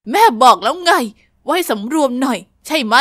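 loud, low angry scold; growled scolding. Acted. Mother scolds her daughter at the party, loud and low. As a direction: snarl the reprimand. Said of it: "ได้ยินเสียงคำราม".

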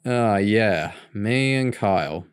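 slow groaning admission; slow, sheepish groan. A narrator's reading. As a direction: groan it out, caught. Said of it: "James groaned".

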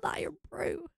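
disappointed whispered aside. Real, not acted. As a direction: drop to a whisper, as if to yourself. Aside about missing item.

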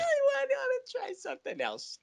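squeaky, far-off-your-own-voice quote; put-on high voice; high-pitched mock voice. Acting out an exasperated listener's reaction.

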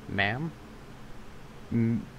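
ordinary talk, nothing marked

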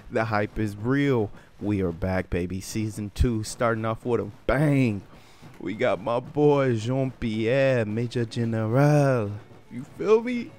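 loud, high hype-man shoutout; hype intro. Real, not acted. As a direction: hype it up for the audience. Hyped season intro.

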